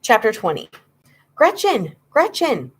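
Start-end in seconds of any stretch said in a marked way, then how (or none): 1.3-2.7 high, urgent repeated call; excited shouting. A narrator's reading. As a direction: shout her name again and again. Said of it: "he shouted my name".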